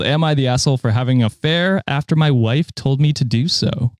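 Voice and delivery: ordinary talk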